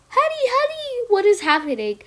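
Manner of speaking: high, anxious mother voice; a mom worried over the walkie. Acted. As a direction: fret like a mother.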